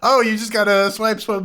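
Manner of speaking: high, breezy know-it-all tone; condescending advice. Retold or imitated. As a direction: airily explain the obvious. Mocking the iPhone user's advice.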